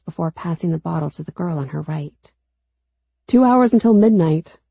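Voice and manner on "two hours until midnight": loud announcement. A narrator's reading. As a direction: call it out loud across the fire. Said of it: "a boy across the bonfire announces".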